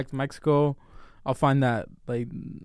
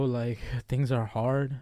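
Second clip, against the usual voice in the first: ordinary talk, nothing marked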